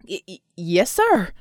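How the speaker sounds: loud stammer leaping up in pitch. A narrator's reading. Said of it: "Colt stammered".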